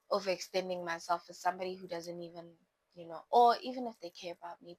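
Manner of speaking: rushed, fast run of words; words tumbling out fast; speeding up without noticing; talking fast. Real, not acted. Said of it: "Ooh, I'm talking fast".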